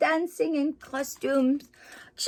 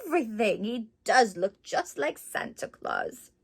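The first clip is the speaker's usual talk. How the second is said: ordinary delivery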